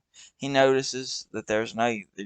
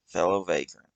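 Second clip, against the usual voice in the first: plain reading voice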